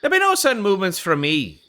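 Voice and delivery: bad, put-on Irish accent; Barnaby Gallows. Undercover man promising no sudden movements, bad Irish accent. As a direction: reassure in a fake Irish accent. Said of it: "my bad Irish accent sound?".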